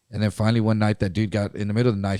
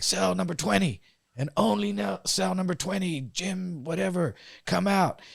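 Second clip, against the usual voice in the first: higher guard voice calling a cell out; 6 semitones higher, 5 dB quieter than usual. Retold or imitated. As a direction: call the cell number like a guard over a speaker. Guard calling over the speaker.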